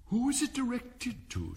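high-pitched character voice; inquiring juryman. A narrator's reading. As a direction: pipe up with a high question. Juryman puts question in court.

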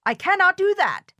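high, dismayed protest; shouted protest. A narrator's reading. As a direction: cry out a refusal. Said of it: "shouted Toad".

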